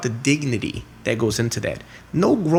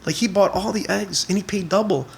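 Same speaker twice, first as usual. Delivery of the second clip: put-on high, excited young boy complaining; a boy puzzled by his father; kid's voice; 9 semitones higher than usual. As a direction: play the baffled son, pitched up. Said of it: "He's like".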